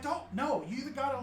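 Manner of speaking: put-on high-pitched, agitated goblin teen; goblin teenager. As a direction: plead urgently as a goblin teenager. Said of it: "She's like".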